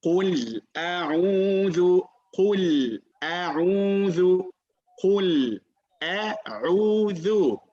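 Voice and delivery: Kul a'udhu drawn out slowly, syllable by syllable; slow recitation demo. Shown as a demonstration. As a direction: stretch out Kul a'udhu for the student.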